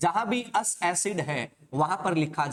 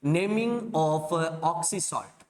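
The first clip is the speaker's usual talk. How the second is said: dictating slowly; much slower than usual. Shown as a demonstration. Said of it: "किसकी बात करेंगे?".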